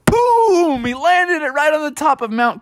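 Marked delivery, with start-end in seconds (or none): 0.0-0.8 sharp high "boom" sound effect. Acted. Narrator crashing the mattress down with a high boom. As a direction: pop out a cartoon boom.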